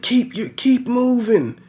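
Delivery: hammed-up cornerman calling instructions; corner coach shouting. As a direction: push the fighter from the corner. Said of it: "they're like".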